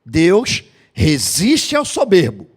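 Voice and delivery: slow, weighty sermon close; preaching conclusion. Acted. Pastor driving home the moral to the congregation, slow and weighty. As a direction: let each word land slowly like a final verdict.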